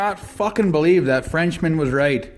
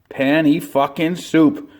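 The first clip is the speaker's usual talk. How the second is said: slow catchphrase; slower than usual. Acted. Catchphrase punchline, drawn out.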